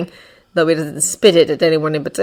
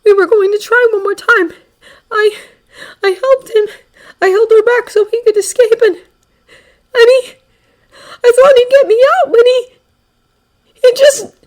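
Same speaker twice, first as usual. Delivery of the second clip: high, loud, sobbing and stammering; shaking with sobs; 9 dB louder than usual. Acted. Izuku retelling how he was abandoned, sobbing. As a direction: sob and stumble over every word. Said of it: "The boy is shaking".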